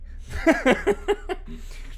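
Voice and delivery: high laugh. Real, not acted. Laughing at own voice.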